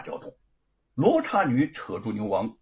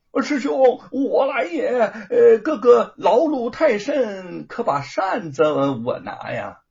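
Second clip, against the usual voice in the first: fawning call; 7 semitones higher, 6 dB louder than usual. Acted. Disguised as Bajie, calling out.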